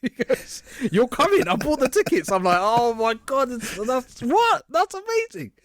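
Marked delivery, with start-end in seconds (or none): 0.9-2.3 high, louder gleeful news; spring the surprise with glee; excited announcing. Retold or imitated. Friend announcing the tickets he bought, excitedly. 2.6-5.5 high, slow, stunned delight; amazed. Retold or imitated. Hearing he's going to WrestleMania, stunned and thrilled. Reacting to free WrestleMania tickets.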